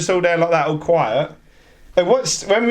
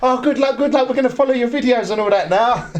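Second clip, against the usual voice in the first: gushing fan; 6 semitones higher than usual. Retold or imitated. Acting out officers wishing luck.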